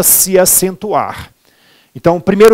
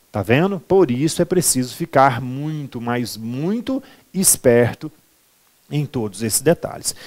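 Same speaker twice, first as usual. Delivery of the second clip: soft confiding; 4 semitones lower, 7 dB quieter than usual. Real, not acted. Teacher confiding advice.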